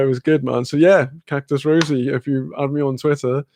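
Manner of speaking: ordinary talk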